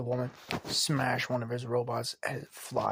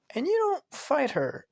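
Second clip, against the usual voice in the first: put-on very high little girl's remark; child voice; 12 semitones higher, 3 dB louder than usual. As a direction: point it out like a curious kid. Child voice questioning Vlad.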